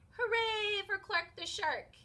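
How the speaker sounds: high, loud hooray; the whole class cheers Clark; cheering crowd. Acted. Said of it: "everyone cheered".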